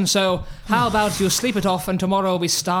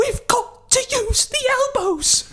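squeaky voice. Acted. Schmeichel squeaky voice.

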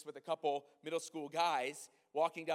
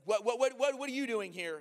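agitated challenge; 7 semitones higher, 8 dB louder than usual. Retold or imitated. Agitated [name] confronting the speaker.

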